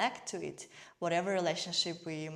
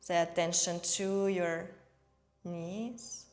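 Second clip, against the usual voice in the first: unhurried meditation guide, evenly paced; guided meditation. Real, not acted. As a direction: guide them gently through each breath, leaving space. Leads breathing and guided meditation.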